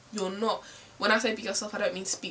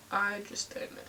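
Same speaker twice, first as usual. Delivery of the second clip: usual speaking voice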